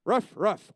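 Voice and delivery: loud ruff ruff bark; imitated dog bark. Comic acting the girl barking at his medical question, loud bark. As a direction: bark the answer back like a dog.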